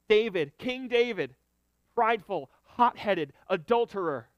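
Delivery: loud, driving preacher's build-up; fervent preaching. Acted. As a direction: preach it with full force to the congregation. Preaches with rising force.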